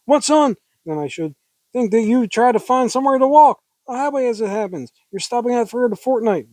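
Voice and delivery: high, pressing interrogation; agitated questioning. Acted. Heroine questions stranger in acted scene.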